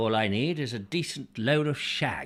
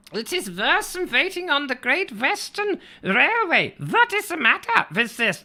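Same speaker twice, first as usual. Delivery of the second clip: much higher, louder hammed-up German accent; shouted comedy German accent; mock German commander; 12 semitones higher, 4 dB louder than usual. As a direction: rant as an impatient German tank commander stuck at the barriers. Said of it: "I've probably done a bit of cultural misappropriation".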